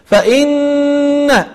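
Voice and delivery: فإن with the doubled n hummed long through the nose; long nasal hum on فإن; held nasal ghunna. Shown as a demonstration. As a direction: sustain the ghunna in فإن two beats. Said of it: "تعطي غنة زائدة".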